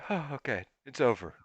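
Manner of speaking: soft, retold sigh of relief; hushed, acted reassurance to oneself; subdued relief. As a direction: let it drop away quietly, acting relieved. Acted relief after the shot.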